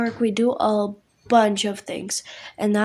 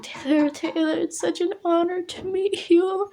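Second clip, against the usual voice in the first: starstruck squeal; 8 semitones higher than usual. Retold or imitated. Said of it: "I'm like".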